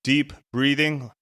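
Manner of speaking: usual speaking voice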